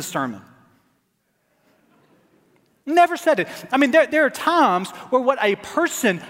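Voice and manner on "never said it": NEVER hit hard and high; insistent repeat, stress on Never. Real, not acted. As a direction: punch the word Never. Preacher stressing denial.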